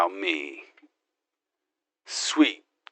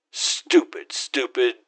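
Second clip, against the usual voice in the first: ordinary delivery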